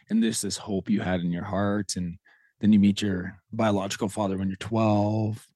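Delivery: normal talking voice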